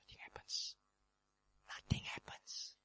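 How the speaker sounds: hushed, breathless whisper. Acted. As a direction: whisper it like awed silence. Silence after the wind.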